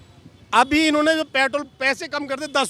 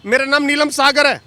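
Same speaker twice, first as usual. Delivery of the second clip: loud, heated self-introduction; angry; 7 dB louder than usual. Real, not acted. Worked-up mechanic giving reporter his name, angry and loud. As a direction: give your name forcefully, still fuming. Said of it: "Neelam जी बहुत गुस्से में है".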